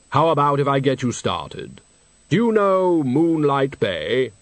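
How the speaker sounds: slow, hollow, solemn deathly voice; Death's voice. Acted. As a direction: speak as grave, patient Death. Death speaks.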